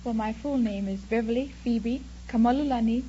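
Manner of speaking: ordinary talk, nothing marked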